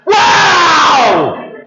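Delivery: high, swooping cry of wonder; amazed. Acted. Fishermen see the full net, amazed. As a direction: exclaim it big, falling from a high start.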